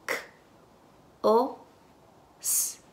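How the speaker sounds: "K, o, s" as isolated sounds with gaps. Shown as a demonstration. Teacher segments cos into phonemes, sound by sound. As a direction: sound out "K, o, s" one at a time. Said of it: "Las fonemas".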